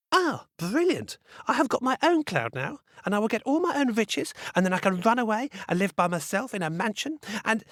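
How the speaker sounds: high, gleeful bratty gush; a spoiled girl crowing over riches; greedy gloating. Acted.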